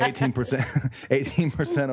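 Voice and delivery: repeats the made-up number through real laughter; laughing. Real, not acted. Amused guest laughing at his own made-up number. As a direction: chuckle while saying it.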